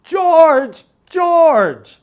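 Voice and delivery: very high, loud, slow panicked crying out; frightened cry. Acted. Terrified wife crying to her husband George. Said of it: "she cried".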